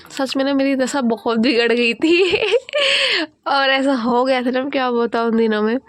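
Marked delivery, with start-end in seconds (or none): 2.4-3.4 a sudden high squeal of a laugh, then falling; high burst of laughter. Real, not acted. Laughing at her own paint-splattered face in the clip, a high squealing laugh.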